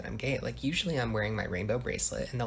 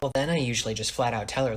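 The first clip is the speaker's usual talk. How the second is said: ordinary talk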